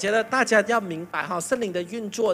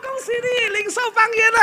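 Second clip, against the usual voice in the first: high, loud, delighted pastor voice; hammed-up pastor announcing success; mock pastor congratulating; 3 dB louder than usual. Pastor's brother congratulating him, high and loud. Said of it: "他就说".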